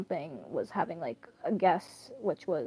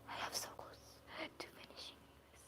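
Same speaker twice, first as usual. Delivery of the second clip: whisper; 26 dB quieter, faster than usual. Real, not acted. Whispering to herself about reading.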